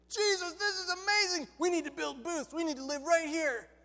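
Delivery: high, gushing exclamation; excited Peter. Acted. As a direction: burst out in hammed-up amazement. Peter overawed at the Transfiguration.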